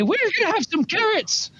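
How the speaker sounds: high-pitched character proclamation; triumphant character voice. Acted. Dago to the table about carrots, high character voice. Player repeats Dago's line to John.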